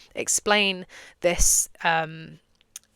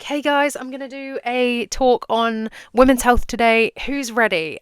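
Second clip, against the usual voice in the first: higher, louder stage host welcome, slow; cheery "Hey guys" to a big audience; upbeat crowd greeting; 6 semitones higher, 6 dB louder than usual. Pretend. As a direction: pump up the room like an eager presenter. Pretend speaker greeting the crowd.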